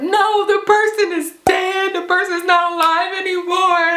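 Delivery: high, wailing mock panic; freaking out. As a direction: re-enact losing it on the phone, pitch way up. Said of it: "I'm freaking out".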